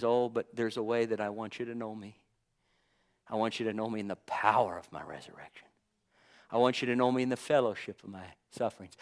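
soft, fast near-whisper; Jesus speaking low to his disciples, hushed; hushed tender plea. Retold or imitated. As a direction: draw them close in a hush. Jesus voiced speaking intimately.

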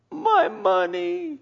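high, slow mock wail over lost money; wailing merchant. Merchant wailing over lost riches, slow and high. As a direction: whine like a ruined merchant. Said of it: "weeping and wailing".

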